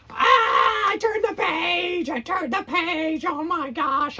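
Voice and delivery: high, loud panicked Grover cry; panicked shout. Acted. Reading to kids, Grover screams that the page got turned. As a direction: shriek it out in full alarm.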